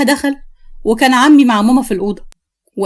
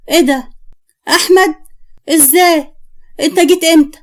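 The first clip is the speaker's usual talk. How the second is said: higher-pitched startled questions; surprised; 5 semitones higher than usual. Acted. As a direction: caught off guard by an unexpected visitor. Mother surprised by son's unexpected arrival.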